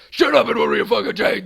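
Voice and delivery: loud, rough abusive tirade; hammed-up furious sandwich-guy rant; nasty yelling. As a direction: scream at the customer like a nasty little monster. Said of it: "a really nasty little monster".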